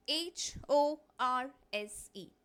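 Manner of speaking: एच ओ आर एस ई spelled letter by letter, slow; spelled out slowly. Shown as a demonstration. Teacher spelling a word for the class, slow and clear. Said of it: "अब ज़रा इस word के ऊपर ध्यान दो".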